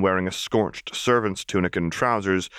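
ordinary delivery, nothing marked

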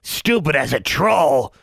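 slow, high creature sneer. Acted. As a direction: jeer along with her. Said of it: "agreed Honey".

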